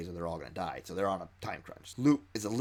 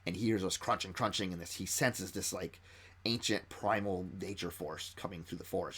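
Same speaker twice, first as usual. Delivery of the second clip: ordinary talk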